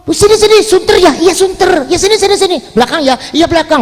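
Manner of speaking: high, hurried mock crowd calls; calling people over. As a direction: wave them in with quick, high-pitched repeats. (meniru orang memanggil-manggil dengan nada tinggi)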